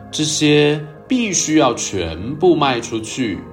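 ordinary delivery, nothing marked

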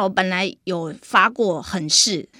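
usual speaking voice

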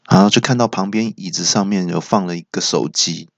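ordinary delivery

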